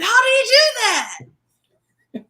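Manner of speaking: high, loud, slow astonished cry; amazed bystander. Retold or imitated. Bystander in the hallway after the jump, high amazed shout. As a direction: gasp it out like a stunned onlooker. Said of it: "jumped and said".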